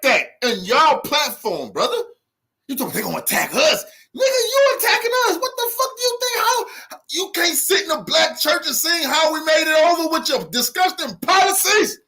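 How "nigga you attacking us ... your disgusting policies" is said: furious shout. Real, not acted. Heated rant at S1's party.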